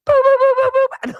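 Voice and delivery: very high, loud rapid boops; imitated alarm. Pump alarm sounding once the repairmen leave, shrill imitated boops. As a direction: mimic an insistent alarm on one high pitch. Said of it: "and it's going".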